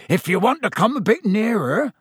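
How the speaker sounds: high, lilting, beaming invitation; Hagrid. A narrator's reading. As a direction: coax the class closer with a swinging tune. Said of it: "beaming around".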